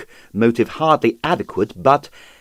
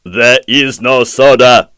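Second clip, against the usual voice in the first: slow, louder grim man's voice; 7 dB louder, slower than usual. Acted. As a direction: flatly shut him down. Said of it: "said Serge grimly".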